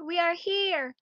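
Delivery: put-on children at the door still shouting. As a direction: shout it again to be heard. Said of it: "started to shout all together".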